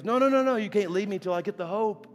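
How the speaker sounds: high, loud pleading refusal; acted insistence, starting high and easing down; urgent protest. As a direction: stop someone from leaving, urgently. Man stops preacher from leaving, in story.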